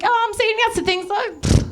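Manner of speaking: high, airheaded self-imitation; mock carefree girl voice; self-mocking naivety. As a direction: toss it off high, mocking your own motto. Mocks her own yes-to-things motto.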